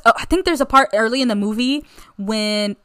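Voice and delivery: ordinary talk, nothing marked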